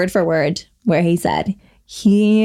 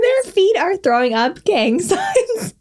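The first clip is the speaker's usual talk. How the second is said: laughing; 9 semitones higher, slower than usual. Real, not acted. Laughing while telling story.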